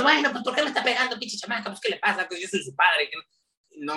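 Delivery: high, fast exasperated dad rant; a father scolding his daughter, fast; mock scolding dad. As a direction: rattle it off like an impatient father. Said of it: "le puedo haber dicho".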